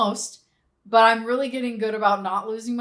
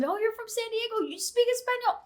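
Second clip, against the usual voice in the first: much higher, mock-cheerful asking; curious locals; 13 semitones higher than usual. Locals quizzing a San Diegan, high mock-cheerful voice. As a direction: play the locals, high and chirpy. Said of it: "everybody's asking".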